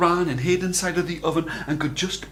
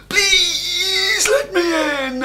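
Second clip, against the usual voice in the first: high, slow, crying plea; pleading Jack; 6 dB louder, much slower than usual. A narrator's reading. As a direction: beg at the door like a tearful boy. Jack begging again.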